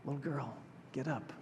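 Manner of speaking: barely above a whisper, close to her ear. Retold or imitated. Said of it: "and just whispers".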